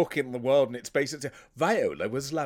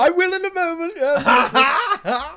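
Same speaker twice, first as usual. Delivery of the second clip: high, loud burst of laughter through the words; laughing shout; 11 dB louder, slower than usual. Real, not acted. Co-host ribbing him with a pompous question, laughing loudly. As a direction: shout it while cracking up.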